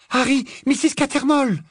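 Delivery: very high alarmed cry; urgent warning. Acted. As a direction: cry out to alert a friend to danger. Hermione warns of danger. (cri d'alerte très aigu)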